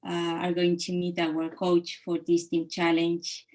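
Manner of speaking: ordinary talk, nothing marked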